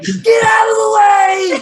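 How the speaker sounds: high, loud, drawn-out mock yell. Penguin clearing his path, high drawn-out yell. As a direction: scream it as an overblown villain.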